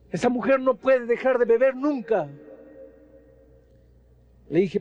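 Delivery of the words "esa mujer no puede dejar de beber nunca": higher, heated retort; angry husband. Retold or imitated. Husband scornfully refusing the pastor, pitched up high. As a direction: snap back with contempt.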